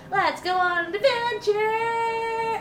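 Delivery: slow, high singsong cheer; singsong excited. Acted. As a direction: sing it out gleefully. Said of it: "and just go".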